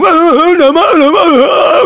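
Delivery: very high hammed-up tantrum wail; mock bawling, stretched and squealed; harder crying. Child rolling on the floor, exaggerated crying. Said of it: "mencoba menangis lebih keras".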